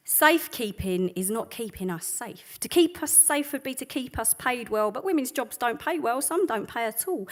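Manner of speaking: rhythmic, defiant spoken-word delivery; slam-poetry read with rising force; spoken word poem. Acted. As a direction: perform it like a protest poem on stage. Performs protest poem.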